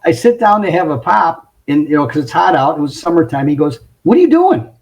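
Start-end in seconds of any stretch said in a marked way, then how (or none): 4.0-4.7 high-pitched, acted-out disbelief; mock-scolding question, pitched way up; indignant challenge. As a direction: pounce on someone caught slacking. Boss catches him taking a break.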